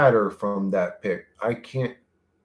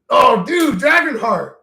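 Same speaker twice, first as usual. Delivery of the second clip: loud, high burst of delight; a real rush of fan excitement; excited recognition; 11 semitones higher, 8 dB louder, slower than usual. Real, not acted. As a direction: blurt it out, suddenly thrilled. Sudden recognition of a film.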